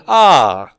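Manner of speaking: "ah" swooping up, then down; long "ah" with a rise and fall in pitch; rising and falling tone. Shown as a demonstration. Teacher showing the circumflex, "ah" in rising-falling tone. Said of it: "combination of rising and falling tone".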